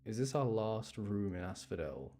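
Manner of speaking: usual speaking voice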